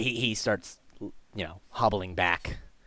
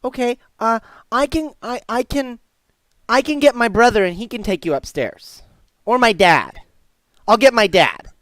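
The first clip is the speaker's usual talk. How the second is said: put-on high, loud little-boy voice; helpful young boy; 13 semitones higher, 9 dB louder than usual. Boy answering stranger about Grandpa, young and helpful. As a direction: answer as an eager kid.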